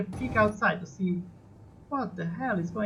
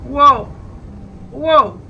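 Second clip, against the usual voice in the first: loud, high startled whoa; real alarm as the ship lurches; 5 semitones higher, 10 dB louder than usual. Real, not acted. Pilot startled by his spinning ship, loud and high.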